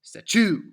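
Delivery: statue with a high, loud second syllable; sta-CHOO, exploding on the end; wrong stress on TUE. Shown as a demonstration. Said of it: "the opposite ways of the ways".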